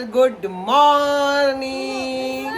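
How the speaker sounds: slow, loud, stretched hello to the camera; loud drawn-out greeting. Real, not acted. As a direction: draw out the good morning warmly and loudly. Greeting viewers at vlog start.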